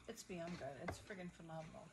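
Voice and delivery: ordinary talk, nothing marked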